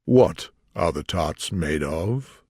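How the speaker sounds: slow, deep, weighty question. A narrator's reading. King questioning the cook, in a slow deep voice. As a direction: drop low and take your time. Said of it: "he said in a deep voice".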